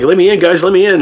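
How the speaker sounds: imitating a scared kid pleading. Kid begging to be let in.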